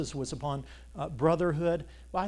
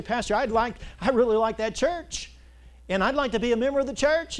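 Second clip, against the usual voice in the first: high, bright eager newcomer; eager visitor; 7 semitones higher, 7 dB louder than usual. Retold or imitated. Pastor voicing a newcomer who asks to join, eager and high. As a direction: voice a keen visitor asking to join. Voices visitor asking membership.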